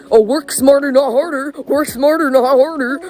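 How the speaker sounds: high, loud sing-song mockery of a cliché; mocking quote. Mimicking what everyone tells teenagers, high and loud. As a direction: parrot the saying in a whiny mocking voice. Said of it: "you know how everyone says".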